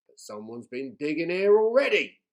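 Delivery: bossy pirate chief taking charge. A narrator's reading.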